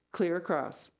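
ordinary delivery, nothing marked

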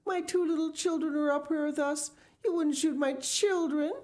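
high-pitched mother begging for mercy; high, frantic appeal; pleading cry. A narrator's reading. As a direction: plead desperately, pitch held way up. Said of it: "cried Missus, Missus Gregg".

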